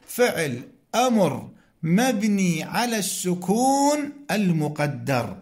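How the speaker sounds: ordinary talk, nothing marked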